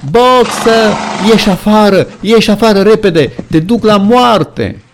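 very high, loud frantic pleading; terrible scream. A narrator's reading. Clover warning Boxer he is taken to death, screaming. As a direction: scream the warning with all your strength. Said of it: "țipă Clover cu o voce teribilă".

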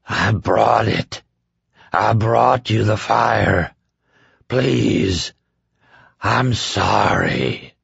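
slow, lightly voiced ruined man, gushing; a hint of a broken man's ecstasy; ecstatic Trash Can Man. A narrator's reading. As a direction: offer it up in slow rapture. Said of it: "voice bubbled ecstatically up".

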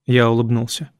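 ordinary delivery

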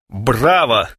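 ordinary delivery, nothing marked

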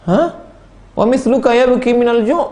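high, loud startled "Hah?"; amazed disbelief. Acted. Student hears the sheikh cries from hunger, stunned and loud. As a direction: react as if you can't believe it.

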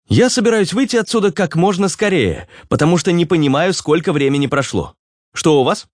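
high, eager character voice; Руслан. Acted. (высокий, деловитый голос персонажа)

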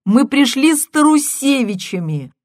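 high, loud insistence; insistent caller. Acted. Caller insists to the husband, high and loud. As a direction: insist he must remember.

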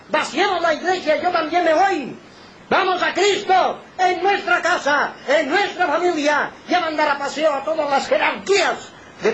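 high, sustained pulpit cry; rally the congregation at full force; fervent high-pitched exhortation; impassioned preaching. Real, not acted.

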